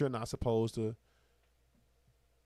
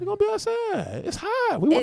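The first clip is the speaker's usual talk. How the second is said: much higher squealed excitement; excited squeal; 6 dB louder than usual. Real, not acted. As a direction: squeal it out with glee. Excited squeal repeating cohost.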